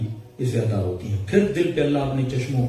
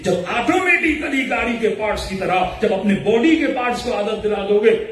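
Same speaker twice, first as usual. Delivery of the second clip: higher, rhythmic preaching cadence; full-force preaching; 6 semitones higher, 3 dB louder than usual. Acted. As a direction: drive each point home from the pulpit. Preaching on piety and habit.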